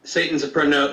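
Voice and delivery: usual speaking voice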